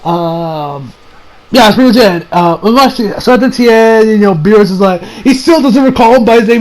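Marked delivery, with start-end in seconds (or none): none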